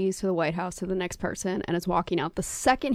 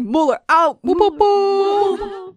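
mic drop swagger; 10 semitones higher, 7 dB louder, much slower than usual. Pretend. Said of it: "just like".

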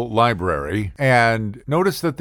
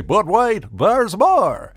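a hint of a TV pitchman, raised high; infomercial pitch; 9 semitones higher, slower than usual. Comic telling landlady story, lightly mock infomercial. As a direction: tease it like an ad announcer. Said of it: "as they say".

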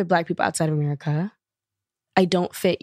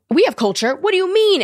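high, loud mock indignation; retold indignant protest; 11 semitones higher, 5 dB louder than usual. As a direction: imitate his affronted comeback, pitch climbing. Retold street argument, man protesting.

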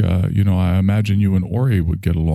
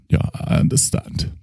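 ordinary talk, nothing marked

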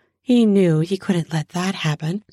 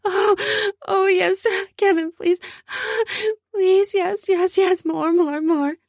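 much higher, crying out yes and please; pleading cries; 13 semitones higher than usual. Acted. Candy begging Kevin against the wall, high pleading cries. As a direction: beg breathlessly high, each word a cry.

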